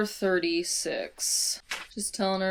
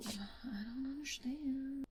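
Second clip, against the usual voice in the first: slow, high whisper in shock; whispered disbelief; 7 semitones higher, 10 dB quieter, slower than usual. Real, not acted. Reader whispers her stunned disbelief at the plot twist. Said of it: "repeated, whispered".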